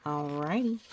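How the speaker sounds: ordinary delivery, nothing marked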